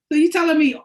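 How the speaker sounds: loud indignant outburst. Real, not acted. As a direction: call them out, fired up. Ranting at parents' guilt.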